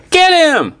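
high, urgent cry; excited high-pitched shout. A narrator's reading. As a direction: cry it out high to send friends chasing. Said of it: "cried Annie".

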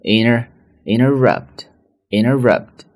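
American inner-rupt; flapped interrupt. Shown as a demonstration. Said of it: "中间的这个t不会读出来".

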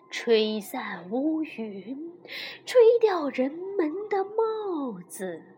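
slow, drawn-out voice of the wind; soft, slow, swooping wind character; boastful wind. Acted. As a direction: stretch the words out like blowing wind. Wind figure boasts in story.

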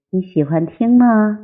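ordinary delivery

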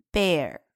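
"bear" with a slightly curled final r; American r-colouring. Shown as a demonstration. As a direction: curl the r at the end of "bear". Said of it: "那么美音有一点儿化". (“bear”结尾带一点儿化音)

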